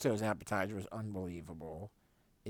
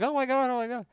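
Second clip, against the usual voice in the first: much higher, louder mock panic; alarmed; 12 dB louder, faster than usual. Mom after nearly tipping the table, high panicked yelps. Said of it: "she was like".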